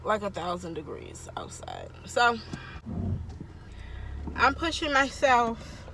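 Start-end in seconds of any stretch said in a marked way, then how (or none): 0.1-1.9 whisper. Real, not acted. Sick vlogger complaining of heat.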